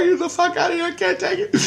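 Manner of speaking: very high-pitched mock wailing; hammed-up hysterical high voice; imitating bawling wife. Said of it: "She's fucking bawling her eyes out".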